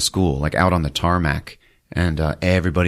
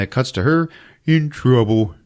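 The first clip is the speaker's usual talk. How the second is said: ordinary delivery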